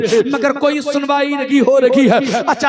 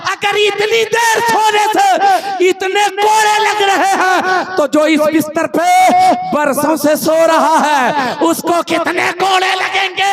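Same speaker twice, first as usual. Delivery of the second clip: preaching shout; 6 semitones higher than usual. Acted. Preacher drives the maid's punchline to the crowd, shouting. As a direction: hammer the question home to the crowd.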